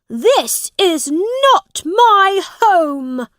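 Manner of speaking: high, loud, indignant prince; crying out. A narrator's reading. Prince rejecting the poor family's room, crying out. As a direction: cry it out slowly in outraged disbelief. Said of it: "he cried out".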